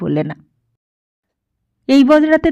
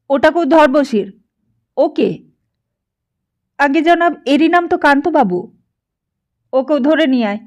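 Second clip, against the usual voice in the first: raised, higher shout of orders; 5 semitones higher than usual. A narrator's reading. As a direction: bark the order to grab him. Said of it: "চেঁচিয়ে বললে".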